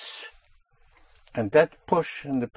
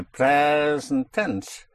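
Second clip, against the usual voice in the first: PRE in "present" stretched long; pushed-down stress; 2 semitones higher, much slower than usual. Shown as a demonstration. Teacher showing how to use present tense, PRE in "present" held long. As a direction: press and hold the first syllable of "present". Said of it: "that you push down".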